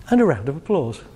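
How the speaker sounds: usual speaking voice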